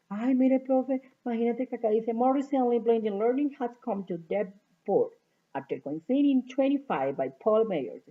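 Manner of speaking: eager student voice. Retold or imitated. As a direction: play the keen student showing the teacher.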